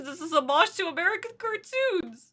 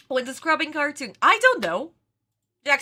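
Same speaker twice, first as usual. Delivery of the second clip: ordinary talk, nothing marked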